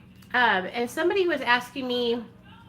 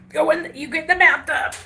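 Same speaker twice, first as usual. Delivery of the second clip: ordinary talk, nothing marked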